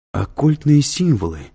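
high-pitched, eager echo of the words; perking up. A narrator's reading. Student hearing of the professor's occult course, perking up. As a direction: repeat it with newly roused interest. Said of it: "Юноша явно воспрял духом".

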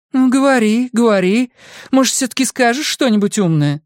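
high, insistent woman; exasperated high-pitched prodding; impatient goading. A narrator's reading. Woman impatiently urges him to talk.

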